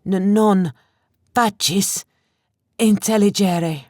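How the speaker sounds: high, stammering foreign plea; frightened Latin-like stutter; terrified captive pleading in a foreign tongue; fearful stammer. A narrator's reading. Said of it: "His voice was thick with fear".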